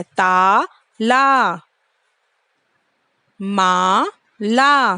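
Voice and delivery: ताला, माला with both aa held very long; long vowels. Shown as a demonstration. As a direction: stretch each aa slowly. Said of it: "दोनों के बाद sound लंबी हो रही है ना".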